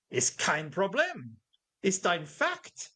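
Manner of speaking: put-on slow, stern German accent. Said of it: "said in a very stern German voice".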